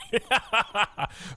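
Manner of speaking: high chuckling laugh; amused, high-pitched giggle. Real, not acted. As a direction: laugh at your own bad accent. Laughs at own bad accent.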